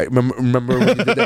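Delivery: fast, awkward starstruck fan voice; lightly voiced nervous superfan; Chris Farley. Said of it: "I'm gonna be Chris Farley with the Remember That Guy".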